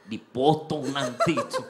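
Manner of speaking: teasing, taunting bully voice; mocking scare voice. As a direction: jeer at him like a pushy neighbour. Harsh man scaring a new convert.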